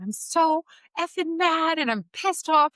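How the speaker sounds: high, loud mock-furious venting; retold furious outburst. Mom venting to her best friend about her son, furious high shout. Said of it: "and I was like".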